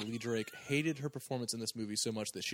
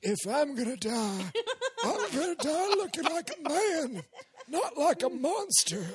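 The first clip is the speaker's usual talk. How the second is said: slow, much higher, hammed-up dying hero with laughter; Paul's line as he says it; 14 semitones higher, 5 dB louder, slower than usual. Podcaster imitates Paul's delivery for friends, hammed-up and slow. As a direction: overact the noble death line, laughing through it. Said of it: "you gotta say it like, uh, like Paul does".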